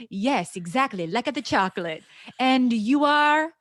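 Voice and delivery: a playful Italian charmer; Italian accent. Acted. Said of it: "with a thick accent".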